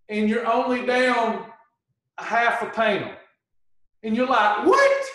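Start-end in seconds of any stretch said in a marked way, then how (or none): 4.7-5.1 sharp, very high startled What?; shocked what. Acted. Dieter sees the scale barely moved, shocked. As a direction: yelp it out like the scale betrayed you.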